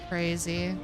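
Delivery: ordinary talk, nothing marked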